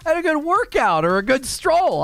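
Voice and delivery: high, nervous, hammed-up innocence; mock defensive husband. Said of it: "defensive husband reply".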